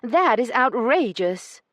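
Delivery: high, loud, slow outraged lady's voice. Acted. Io protesting the doubled price, slow and outraged. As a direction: spell out each word in indignation.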